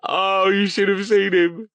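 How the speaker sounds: high, loud delighted tease; gleeful teasing. A narrator's reading. Eddie to Verity about Stone, loud and gleeful. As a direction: crow over a friend's embarrassment.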